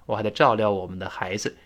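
usual speaking voice

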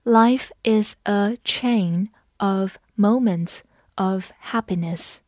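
slow, careful classroom read-aloud; slow model reading for students. Shown as a demonstration. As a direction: read it slowly, word by word. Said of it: "好，我们慢读一遍。".

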